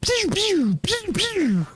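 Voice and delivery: high falsetto pew pew, falling; blaster sound. Shown as a demonstration. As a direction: redo the laser shots, high and silly. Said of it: "Wait, what was that".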